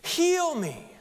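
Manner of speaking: high, loud, slow desperate cry. Acted. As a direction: call out with everything he has. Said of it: "he yelled even louder".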